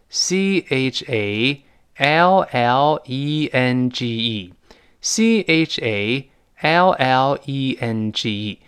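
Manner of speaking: letters spelt out slowly, one by one; drawn-out, deliberate letter names; slow spelling. Shown as a demonstration. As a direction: spell it for a class to copy. Teacher spells the word aloud.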